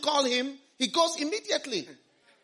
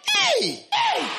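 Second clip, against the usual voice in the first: calling out. Real, not acted. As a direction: rouse the congregation with a high shout. Preacher calling out to congregation.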